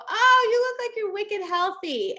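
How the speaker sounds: high, loud, drawn-out "Oh"; gushing. Retold or imitated. Retelling her remark to a shopper, gushing. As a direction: gush with delighted surprise, slow and loud.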